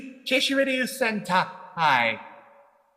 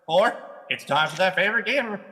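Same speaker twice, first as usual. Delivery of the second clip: normal talking voice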